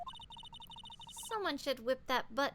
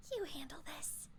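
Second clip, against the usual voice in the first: whisper; 12 dB quieter than usual. Real, not acted. Aside to partner, unvoiced.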